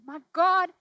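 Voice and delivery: loud, high cry of anguish to God; crying out forsaken. Pretend. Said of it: "with respect say to him".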